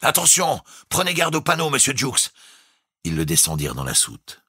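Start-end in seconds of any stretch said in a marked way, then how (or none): 0.0-2.3 high, eager fawning call; obsequious warning. A narrator's reading. The crewmen fawningly warn Jukes at the hatch. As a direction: warn him with servile eagerness. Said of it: "obséquieux avertissements".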